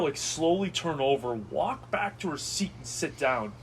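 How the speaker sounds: normal talking voice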